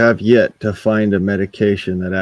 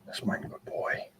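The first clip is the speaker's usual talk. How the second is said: whispering; 19 dB quieter than usual. Real, not acted. Soft praise to pet.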